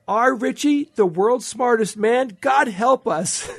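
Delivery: high, slow motherly quote, amused; exasperated mother. Retold or imitated. Reading his mother's quote, high exasperated mom voice. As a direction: play a doubting mom, high and drawn out. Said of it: "his mother said".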